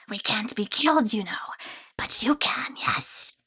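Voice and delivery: sinister whispering creature voice; creepy grain spirit whisper. Acted.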